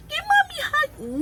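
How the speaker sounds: high singsong plea for a hug; pleading mommy voice. Retold or imitated. Mother retelling begging her daughter for a hug, high and pleading. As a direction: coax sweetly, pitch way up. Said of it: "I'm like".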